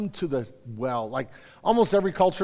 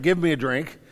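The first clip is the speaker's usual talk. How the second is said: ordinary talk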